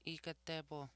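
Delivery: normal talking voice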